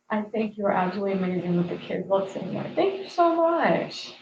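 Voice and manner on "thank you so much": heartfelt thanks. Real, not acted. Thanking viewer for kind comment.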